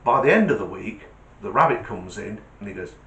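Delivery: ordinary delivery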